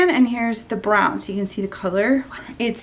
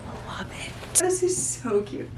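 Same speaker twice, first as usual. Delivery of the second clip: tiny whisper; 9 dB quieter than usual. Real, not acted. Whispering her love for the bag. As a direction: barely breathe it.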